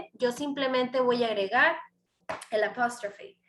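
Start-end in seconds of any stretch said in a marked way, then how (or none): none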